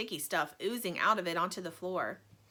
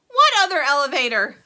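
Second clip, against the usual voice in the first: loud, high shriek of alarm; startled scream; 5 semitones higher, 14 dB louder than usual. A narrator's reading. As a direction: scream the question in fright. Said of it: "screamed Missus T V".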